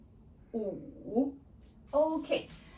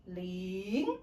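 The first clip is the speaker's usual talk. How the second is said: "ling" starts low and climbs; rising second tone. Shown as a demonstration. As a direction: draw out "ling", sliding up like a question. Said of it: "start lower and then go up".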